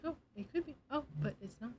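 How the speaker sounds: soft, hushed demo; quiet. Shown as a demonstration. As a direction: barely voice it. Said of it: "I was talking like this".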